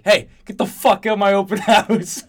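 high, loud outburst breaking into laughter; mock angry yell. Retelling a movie scene to co-host, mock shouting. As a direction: yell it like a furious host, amused. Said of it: "He's like".

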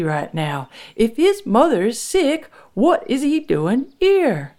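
high, slow, emphatic warning; angry insistence. A narrator's reading. Frank warning his wife about the visitor, insistent. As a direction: drive each point home to your wife. Husband argues about intruder.

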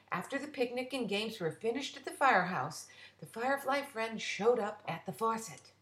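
ordinary delivery, nothing marked

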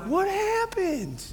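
high, soft, slow coaxing question; tender worried dad. Retold or imitated. Dad to crying daughter Savannah, high soft coaxing. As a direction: coo it like a dad melting for his crying girl.

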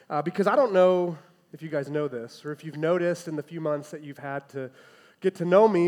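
ordinary delivery

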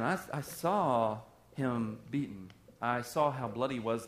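hushed grief. Acted. Thomas voiced, grieving.

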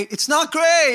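high, loud outburst of correction; emphatic rebuttal. Real, not acted. Preacher rebutting excuses about Scripture, emphatic. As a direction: cut the excuse down, exasperated and loud.

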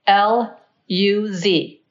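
slow, deliberate spelling, letter by letter; spelling slowly. Retold or imitated. Spelling her name slowly for strangers who mishear it. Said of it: "I will spell my name very slowly".

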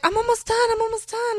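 high, sing-song imitation of the dawdling bridesmaid; mocking bridesmaid. Bride mimicking her late bridesmaid, high mock voice. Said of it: "And she's like".

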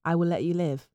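put-on British accent, mostly measured and easy. Host posing as a Brit defending the president, British accent. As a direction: play a polite Brit, accent held throughout. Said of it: "do a, a British accent".

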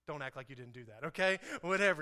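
normal talking voice

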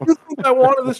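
high, loud, hammed-up anguish; mock anguish. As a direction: cry out in melodramatic pain. Mock anguish over the kill.

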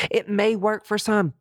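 high, slow put-on voice; high sarcastic drawl of a sceptic; mocking aside. Mock aside voice.

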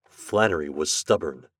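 ordinary delivery, nothing marked

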